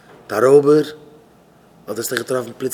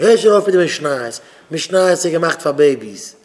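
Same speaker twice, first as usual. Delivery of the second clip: raised, loud and drawn-out protest; 3 semitones higher, 6 dB louder, much slower than usual. Acted. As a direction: scoff loudly at the learner. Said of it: "ein älterer Jid kommt dran zu ihm und sagt". (אפלאכנדיק, אויף א הויך קול)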